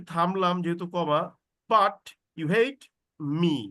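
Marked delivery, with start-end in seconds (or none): 1.7-3.6 but you hate spoken slowly together, me released separately; slow down and break before me; me set apart. Shown as a demonstration. Teacher demonstrating the clause, me separated. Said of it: "me-টা আলাদা হয়ে যাবে".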